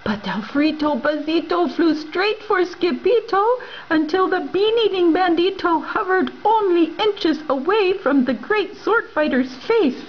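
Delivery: ordinary delivery, nothing marked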